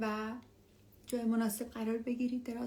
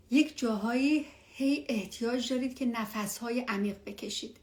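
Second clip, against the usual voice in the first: ordinary talk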